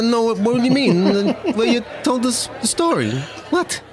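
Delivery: put-on high, fast Jersey tough-guy accent; Joe Pesci impression. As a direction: play a Goodfellas-style New Jersey wiseguy. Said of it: "You do that accent so well".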